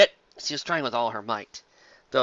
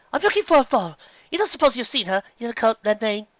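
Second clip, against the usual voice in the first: much higher, worried pony mare; anxious mare voice; 9 semitones higher, 4 dB louder than usual. Acted. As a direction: ask urgently as a worried mare. Carrot Top asks for foal.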